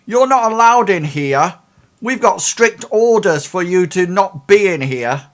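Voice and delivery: high, loud challenging guard voice; suspicious guard. Acted. A security guard confronts the twin by the bed, high and loud. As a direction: demand it like a guard who caught an intruder.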